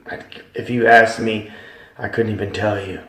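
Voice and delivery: ordinary talk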